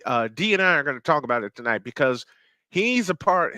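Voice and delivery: ordinary talk, nothing marked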